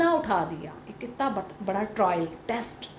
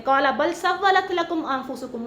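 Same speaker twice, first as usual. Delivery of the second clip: Quranic recitation; 3 semitones higher, slower than usual. Shown as a demonstration. Reciting the father's Quranic words.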